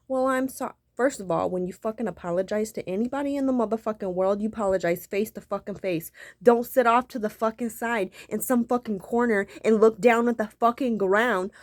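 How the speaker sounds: ordinary delivery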